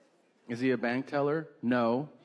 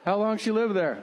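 suddenly hooked on the story; roped in; 6 semitones higher than usual. Retold or imitated.

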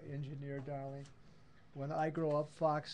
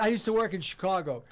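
louder, higher, quick 'I used to work in Chicago'; excited Chicago line; 5 semitones higher, 6 dB louder, faster than usual. Shown as a demonstration. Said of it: "see if he can do that".

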